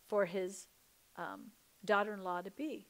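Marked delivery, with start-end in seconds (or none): none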